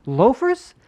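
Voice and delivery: high, accusing jealous wife. Retold or imitated. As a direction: demand it, stung and suspicious.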